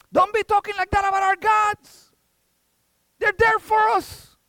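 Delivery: high, loud indignant scolding; irate dad. Acted. Dad defending his gods to his son, irate. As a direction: shout in offended defence, as an acted father. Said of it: "Well, the dad got irate".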